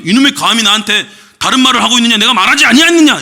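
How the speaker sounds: hammed-up divine rebuke; mock angry God. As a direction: bellow like an offended deity. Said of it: "이렇게 얘기 안 하세요".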